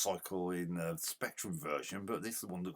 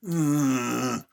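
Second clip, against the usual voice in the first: loud disgusted groan; frustrated groan; 5 semitones higher, 11 dB louder than usual. Real, not acted.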